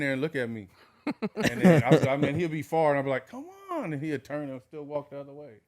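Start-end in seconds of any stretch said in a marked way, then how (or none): 3.3-3.9 high, exasperated call across the park. Retold or imitated. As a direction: yell after a dog that won't come. Calling dog that walks away.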